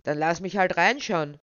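loud angry demand; forceful, enraged outburst; trembling with rage. A narrator's reading. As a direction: burst out in fury. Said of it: "mit vor Wut bebender Stimme".

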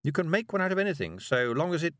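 a busy, slightly nervous witch; Miss Tick. A narrator's reading. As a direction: witch talking a girl into a walk. Miss Tick's voice.

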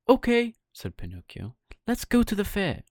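put-on high, loud eager puppet boy; bursting to go; excited Pinocchio.

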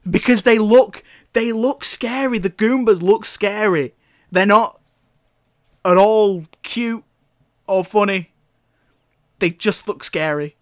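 ordinary delivery

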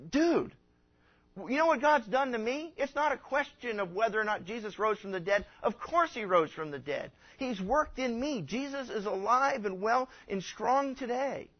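high, emphatic, slowing to a firm close; a converted man answering a doubter, excited and sure; incredulous convert. Retold or imitated. A man answering a silly question about God, high and emphatic.

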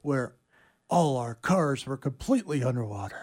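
a hint of trailer narrator; lightly voiced trailer line; movie trailer voice. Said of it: "do the movie trailer".

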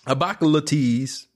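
put-on high, heavy Baltimore accent again repeated; mock Baltimore accent. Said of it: "Baltimore people talk".

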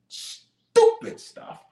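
very high, drawn-out derision; exasperated mockery. Real, not acted. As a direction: stretch it out in high-pitched disbelief. Preacher mocking the congregation's foolish thoughts.

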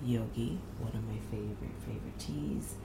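hushed whisper so nobody wakes; breathy early-morning whisper; library whisper. Real, not acted. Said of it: "I am whispering because".

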